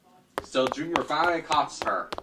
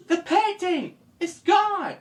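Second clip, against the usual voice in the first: gasp, then a high slow alarmed cry; startled high exclamation; shocked gasp; slower than usual. Acted. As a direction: sound the alarm in disbelief. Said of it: "he said".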